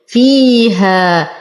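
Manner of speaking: slow فيها with evenly held long vowels; full kasra. Shown as a demonstration. Teacher reciting for students, slow measured فيها. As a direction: draw out both vowels of فيها. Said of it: "نتم كسرة الفاء".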